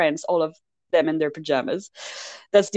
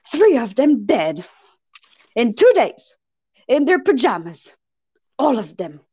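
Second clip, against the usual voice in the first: frantic French inspector; 6 semitones higher, 4 dB louder than usual. Retold or imitated. Inspector going crazy over three dead Americans, exasperated. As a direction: play the panicked inspector counting the dead. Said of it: "the inspector is going crazy".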